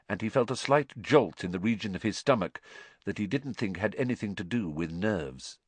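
plain reading voice

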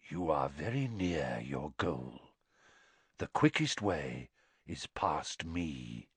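low, quiet, slow hoarse voice; deep hoarse sphinx. A narrator's reading. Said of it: "spoke in a deep, hoarse voice".

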